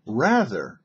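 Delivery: usual speaking voice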